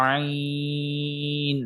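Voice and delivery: আইন very slow, four alif long; very slow আইন held long; long ain. Shown as a demonstration. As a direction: stretch আইন from the throat. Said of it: "এটাকে একটু লম্বা করে পড়তে হবে".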